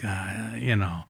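put-on a touch of a fed-up older brother; muttered complaint. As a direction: grumble at him under your breath. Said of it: "I'm like".

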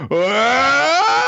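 high loud wah of fright; startled yelp. Acted. Boy slapped by Mash, high startled yelp. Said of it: "he's like".

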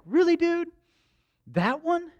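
very high, rising disbelieving question; incredulous. Acted. Believer questioning who God picks, high incredulous voice. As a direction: squeak it upward in mock disbelief.

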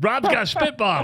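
high, teasing voice quoting listeners; mocking listener voice. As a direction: lightly mimic a gossiping fan. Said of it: "people have been writing in".

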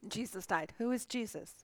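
throat catching, choked words; choking. Real, not acted. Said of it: "Man, I'm choking badly".

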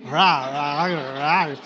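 a growly har, har of a chewing bear; bear chewing noise. Shown as a demonstration. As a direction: imitate a bear gnawing on its prey. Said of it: "Is that pretty good, bear?".

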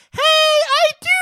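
high, loud squealed "I do"; mock eager bride. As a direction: squeal it like a bride leaping into his arms. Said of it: "she goes".

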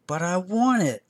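high whiny child's demand, mildly played; a small boy insisting on what he wants; demanding toddler. A narrator's reading. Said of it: "little three-year-old Andy demanded".

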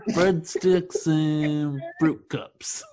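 slow, deadpan punchline. Acted. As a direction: drag each word out flatly.

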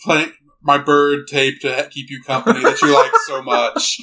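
robotic monotone; Soundwave. Acted. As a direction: imitate a droning robot, halting over the words. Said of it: "talks like this".

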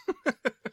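short high chuckle; brief giggle at your own impression; laughing. Real, not acted. Co-host amused by his own quote, high chuckle.

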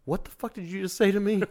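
high-pitched mock bewilderment; baffled disbelief. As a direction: retell it as an incredulous squeal. Retold baffled reaction to dialect.

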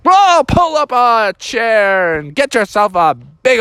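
slow, expansive breakfast welcome from Dad; hearty dad. Acted. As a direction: draw it out like a jovial host. Dad invites kids to breakfast.

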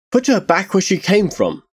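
restrained but urgent angry command; snapped order. Acted. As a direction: snap it back without shouting. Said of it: "Tarsia snapped".

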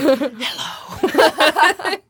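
high, loud, spooky-silly hello; mock possessed. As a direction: greet as if jokingly possessed. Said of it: "Yes, you're not possessed".

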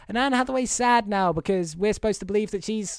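ordinary talk, nothing marked

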